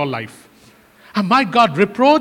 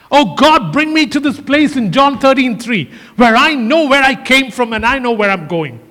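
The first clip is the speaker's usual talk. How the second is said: fervent prayer; 3 semitones higher, 3 dB louder than usual. Real, not acted. Preacher prays aloud to God.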